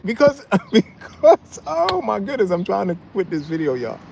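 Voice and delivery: high-pitched, breaking up with real laughter; laughing while talking. Real, not acted. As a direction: try to get the words out through laughs. Cracking up, struggles to continue.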